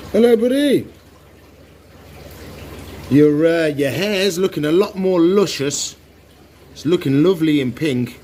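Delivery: excited pet greeting. Real, not acted. Greeting axolotl affectionately.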